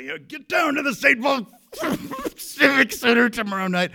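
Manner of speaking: very high, loud shout that breaks into a laugh; fired-up shout cracking into laughter. Retold or imitated. Wrestler trying a threat at the camera: shout collapsing into giggles. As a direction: try to roar the threat but giggle through it. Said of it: "He was gonna get all fired up".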